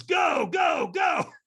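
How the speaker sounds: high, loud urging shout; frantic coach's send-off; urgent shouting. Retold or imitated. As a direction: yell to send someone charging off. Trainer urging him onto coals.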